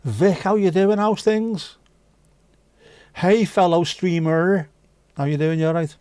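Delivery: high, bright hello to a friend; cheery greeting. Real, not acted. Streamer welcoming fellow streamer Vic into chat, high cheery greeting. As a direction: call out a big welcome.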